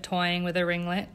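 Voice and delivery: usual speaking voice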